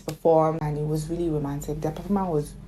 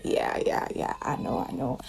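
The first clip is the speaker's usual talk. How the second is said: hushed brush-off; 5 semitones lower, 5 dB quieter than usual. Retold or imitated. Layla's brushing-off reply.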